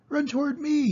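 very high goose yell; the gander calling Wilbur over, very high. A narrator's reading. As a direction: honk it out to the pig. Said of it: "yelled the gander".

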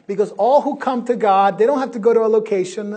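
loud, high, fast preaching; full-force preaching. Acted. Preacher loudly driving home his point to the congregation.

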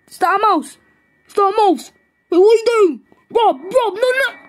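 high-pitched, climbing panic; acted alarm. Friend seeing Rob with the mouse, alarmed. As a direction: cry out in mounting horror.

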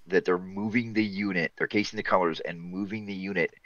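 ordinary talk, nothing marked

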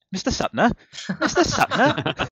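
mock polite hotel manager calling a guest's name. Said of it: "like a hotel manager".

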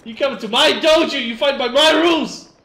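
mock dojo master. Quoting a movie line after a kill.

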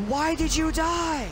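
high, drawn-out wail of frustration; exasperated cry. Real, not acted. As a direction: demand an answer, slow and high-pitched. Exasperated at teammate dying.

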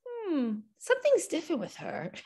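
put-on little boy voice. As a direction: play the curious small son. Son's puzzled remark about baby.

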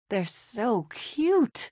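slow, stretched-out cooing; drawn-out gush, lifting in pitch; adoring coo. Acted. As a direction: melt over something adorable. Cooing over cute little creatures.

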